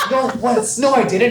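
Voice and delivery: high laughing mock denial. Patron protesting to security, high and laughing. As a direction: deny it through giggles.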